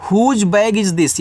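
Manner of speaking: slow, "Whose" on a falling tune; slow model sentence. Shown as a demonstration. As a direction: ask it slowly for the class. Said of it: "आप इनका use भी कर सकते हो". (धीमा उदाहरण प्रश्न)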